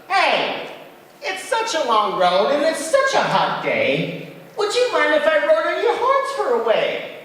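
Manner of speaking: high, loud squeaky bug voice; a tiny bug calling up to the moose. Acted. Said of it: "The Binglebug called out".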